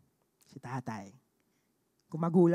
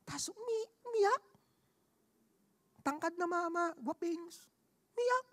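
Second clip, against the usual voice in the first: pitched up, hushed amazement; amazed; 8 semitones higher, 6 dB quieter than usual. Real, not acted. Telling the church the tall man cried, amazed. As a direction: marvel that such a man wept.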